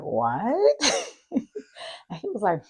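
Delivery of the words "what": louder high rising what; loud disbelieving what; husband's stunned what. Retold or imitated. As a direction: blurt a shocked what. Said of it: "he's like".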